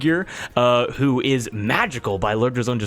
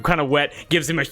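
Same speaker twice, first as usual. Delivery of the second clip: usual speaking voice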